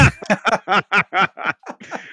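high rising burst of laughter; delighted laugh. Real, not acted. Delighted by buyer's reply.

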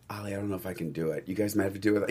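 soft, low, hurried backing out; hushed, rushed retold hesitation; retold reluctant plea. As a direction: quietly mumble the excuse, quick and low. Retold wavering plea to friend.

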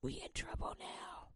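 We in trouble now whispered in dread; We in trouble now hushed to a whisper; scared whisper. Acted. Same line, now whispered.

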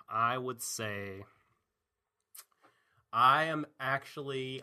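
slow drawn-out rating. Real, not acted. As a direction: slowly draw out each word.

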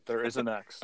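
quiet reassurance of "There is a next". Shown as a demonstration.

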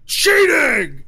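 shrill, loud shout of cheating; shouted accusation. Acted. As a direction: yell it like a sore loser caught out. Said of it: "He's like".

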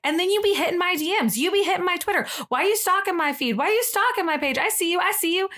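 high, rapid-fire sassy rant in slang; over-the-top movie character. Retold or imitated. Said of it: "the way she talks and stuff".

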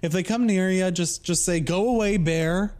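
breezy folksy ranger advice; a hint of a Minnesotan ranger; imitating the park ranger. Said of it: "And he was like".

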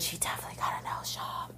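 hushed gossip aside; gossipy whisper. Real, not acted. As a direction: whisper it like a secret.

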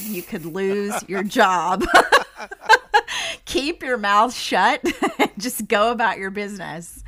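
laughing through a slow self-warning. Retold or imitated. Warning herself not to speak, laughing. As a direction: laugh as you tell yourself off.

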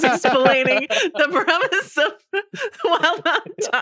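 can barely get it out for laughing. Real, not acted. Telling friends about the bee movie, laughing hard. Recalling friend explaining premise.

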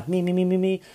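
high singer's warm-up run; playful high-pitched mi-mi-mi; mock vocal warm-up. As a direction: warm up the voice in quick high notes. Vocal warm-up before the review.

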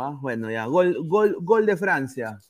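normal talking voice

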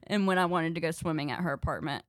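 ordinary talk, nothing marked

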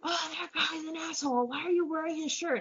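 high, hectoring stranger's voice, acted out; retold yelling. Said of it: "starts yelling at me like".

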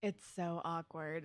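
usual speaking voice

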